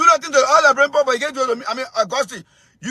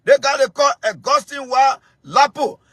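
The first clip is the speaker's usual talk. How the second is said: ordinary talk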